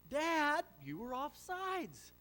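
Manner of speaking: high, loud whine to dad, then slow; a little boy pleading his case; protesting kid. Retold or imitated.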